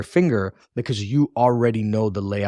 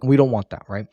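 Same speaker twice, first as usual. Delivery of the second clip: ordinary talk, nothing marked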